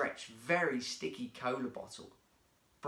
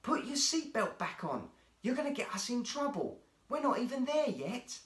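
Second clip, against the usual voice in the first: anxious scolding; 9 semitones higher than usual. Acted. Jake scolds Brian anxiously.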